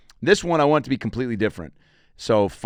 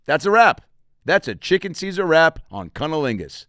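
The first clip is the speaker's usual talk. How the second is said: punchline declaration; 5 semitones higher, 4 dB louder than usual. Acted. Punchline about quitting.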